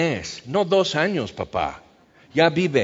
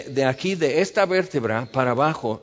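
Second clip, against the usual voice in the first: ordinary talk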